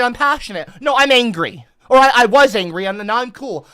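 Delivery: ordinary talk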